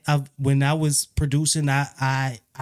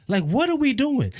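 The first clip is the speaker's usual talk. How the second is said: high, incredulous what are we doing; exasperated; 10 semitones higher than usual. Real, not acted. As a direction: throw up your hands in disbelief. Exasperated about [name]'s accents.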